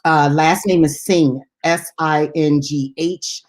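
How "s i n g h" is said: each letter said slowly and separately; slow spelling. Shown as a demonstration. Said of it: "let me spell it for you".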